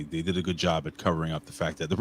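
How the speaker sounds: ordinary talk, nothing marked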